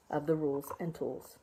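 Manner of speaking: ordinary delivery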